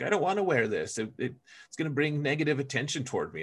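mock whining complaint; whiny reluctant recruit. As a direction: protest like a kid told to wear it. Reluctant unit voiced whining.

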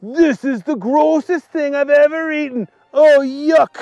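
acted outburst of disgust; disgusted yell. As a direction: exclaim high while thoroughly grossed out. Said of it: "he yelled".

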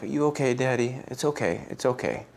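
pat daddy gently with the words; lightly voiced toddler comforting his dad; comforting child.

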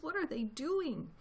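high, small questioning cry; soft and high, a little one crying out; small foxes' cry. A narrator's reading. Small foxes asking about the noise overhead, crying out. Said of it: "cried the small foxes".

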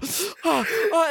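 high, breath-heavy mock gasps; acted-out panicked "oh"s; Charlie Cox. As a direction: gasp as if suddenly struck blind. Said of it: "start doing the Charlie Cox thing".

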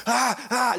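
two high mock wails; screaming, crying toddler. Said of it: "just screaming, yelling, crying".

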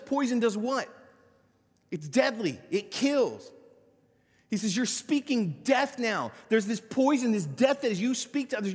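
high, loud, driving pulpit delivery; preaching at full force. Acted. Pastor preaching loud to his congregation about sin. Preacher building on Romans passage.

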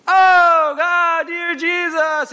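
very high, very loud hammed-up wail; mock a Pharisee praying for show; showy wailing prayer. Pharisee outside the temple, hammed-up wailing prayer. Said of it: "you would be like".

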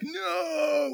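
very high mock wail; long, high cry of mock dismay; hammed-up horror. Pastor acting the brothers as the cup is found, hammed-up horror.

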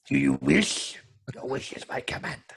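whispery Wishmaster voice; half-whispered, sinister wish-granter; genie. Retold or imitated. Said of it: "He looks like the Wishmaster".

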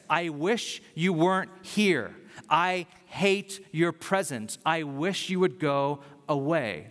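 slow, weighted words of contempt; deliberate rejection. Retold or imitated. Cursing someone whose presence you hate, slow and deliberate. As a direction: spell out the dislike slowly, word by word.

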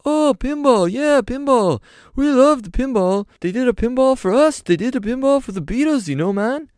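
very high, loud British voice; excited about pinball, way up high. Retold or imitated. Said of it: "He's like".

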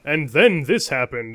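put-on booming old-time radio narrator; radio announcer voice. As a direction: announce it like a vintage radio show. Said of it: "the radio voice announcer doing his".

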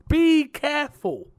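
high, loud, slow be careful; emphatic warning. Real, not acted. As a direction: warn them, drawing it out. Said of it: "Be careful out there, buyers".

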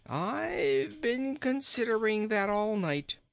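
high, slow, drawn-out storybook king; jolly king. Acted. King in the well answers the boy, high and slow. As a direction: stretch it out high and fussy.

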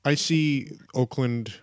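usual speaking voice

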